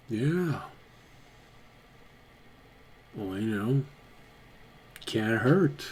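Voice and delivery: quiet, halting goblin giving grudging consent; reluctant goblin mentor. Acted. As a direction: agree softly, weighing it up as the goblin. Game master voices goblin as reluctant mentor.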